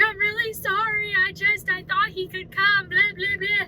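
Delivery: a hint of breaking down while apologising; tearful apology. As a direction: replay her own teary, high-pitched sorry. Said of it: "started breaking down even more".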